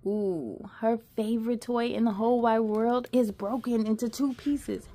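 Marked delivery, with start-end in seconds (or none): none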